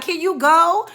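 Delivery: high, loud, fed-up ask; loud impatient demand. Retold or imitated. To the meddling friend, loud impatient demand. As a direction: shoo her off loudly.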